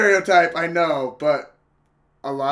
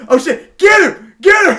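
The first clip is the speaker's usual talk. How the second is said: much higher, much louder hyped audience shout; yelling out; 17 dB louder than usual. Retold or imitated. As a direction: cheer the hero on at full volume. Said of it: "they're just, like, yelling out".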